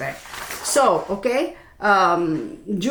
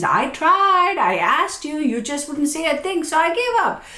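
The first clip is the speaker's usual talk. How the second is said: higher frustrated partner voice; complain, hurt and fed up; 7 semitones higher than usual. Retold or imitated. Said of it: "couples having those kind of conversations".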